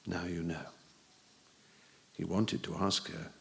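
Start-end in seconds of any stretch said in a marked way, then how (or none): 0.1-0.7 low, soft weary voice; quiet and resigned. A narrator's reading. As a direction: say it flatly, worn out. Resigned bruised teacher.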